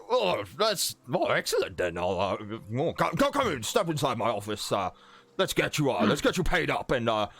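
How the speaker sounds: halting, flustered magistrate voice; Walden Crane. Acted. Magistrate inviting the adventurers into his office, flustered welcome. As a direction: usher guests in, stammering and swooping high. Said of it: "He's like".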